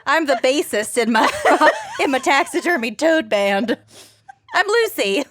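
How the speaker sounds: giggling through the words; laughing while talking. Real, not acted. Laughing toad band joke.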